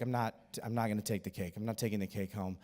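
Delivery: low, soft retold refusal; low-key refusal. Retells sister's first low-key refusal.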